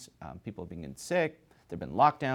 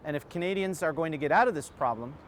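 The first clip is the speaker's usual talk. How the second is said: higher, louder presenter read to wrap up the report; news report sign-off; 6 semitones higher, 4 dB louder than usual. Acted. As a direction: sum it up like a TV reporter, projected and emphatic.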